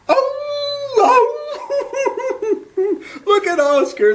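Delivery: high and loud, laughing through the words; howling with laughter. Acted. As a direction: crack up mocking him. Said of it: "they howled with laughter".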